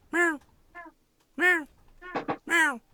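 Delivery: high mewing cat imitation; cat meowing. Cat owner imitating his cat's demanding meows. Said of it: "he's just like".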